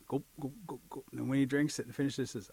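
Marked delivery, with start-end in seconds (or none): 0.1-1.0 quick imitated swallowing sounds; cartoon glug, glug, glug; gulping noises. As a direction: mimic gulping down a drink. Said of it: "the warrior drinks it".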